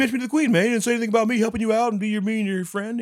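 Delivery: high, loud, fast whine; whiny jealous friend. Retold or imitated. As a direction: complain like a jilted buddy. Duchamp jealous complaint.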